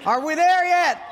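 put-on high, loud nagging child; whiny kid. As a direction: yell it from the back seat. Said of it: "kids nagging".

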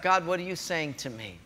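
soft, hushed question to God; quiet prayer. Retold or imitated. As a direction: ask God gently and quietly.

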